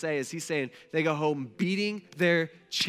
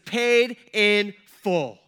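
slow proclaimed cry; 4 semitones higher, 8 dB louder, much slower than usual. Acted. Jesus' final cry, preacher performs.

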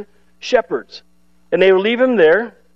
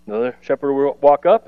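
ordinary delivery

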